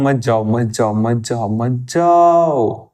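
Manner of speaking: low, repeated plea ending on a long drawn-out call; hammed-up calling back of something escaping; mock pleading. Teacher calling to a light ray he is pulling back, mock pleading. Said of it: "मना कर रहा हूं".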